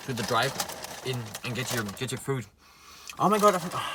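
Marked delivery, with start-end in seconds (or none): 3.2-3.8 sudden high, loud surprise; surprised. Real, not acted. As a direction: caught off guard, blurt it out. Just discovered sour cream.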